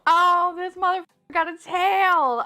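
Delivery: high-pitched amazed delight; delighted squeal. Real, not acted. Discovering the toy has a tail, delighted squeal. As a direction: exclaim it high, thrilled by the find.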